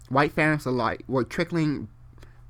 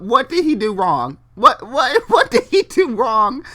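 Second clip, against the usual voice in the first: loud high disbelieving shout; outraged yell; 10 semitones higher, 8 dB louder than usual. Real, not acted. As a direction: shout it in disbelief, louder each time. Outraged reaction while reading.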